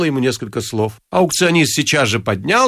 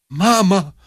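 higher, blurted cry; mild outburst, raised pitch; loud exclamation; 6 semitones higher than usual. A narrator's reading. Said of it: "сказал Ипполит Матвеевич громко".

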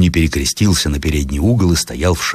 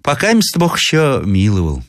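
higher-pitched pious answer; old woman; 7 semitones higher than usual. A narrator's reading. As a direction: answer as a devout old villager. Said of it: "отвечала старуха". (богобоязненная старуха)

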